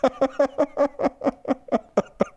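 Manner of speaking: high cackling laugh; real high laugh; amused laugh. Real, not acted. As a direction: burst out laughing at the comment. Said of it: "Reverend Saint sound like my drunk uncle".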